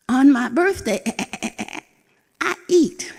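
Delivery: high, bright put-on bluster; an old goat puffing up to scare a cat; scheming old goat. Old goat bluffing the wildcat about her birthday, high and boastful.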